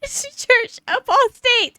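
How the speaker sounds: high, slow, laughing mock sermon; mock preacher, laughing. Reading the fanatic's chat message aloud, mock preaching through laughter. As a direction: wail it out through tears of laughter. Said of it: "somebody's posted".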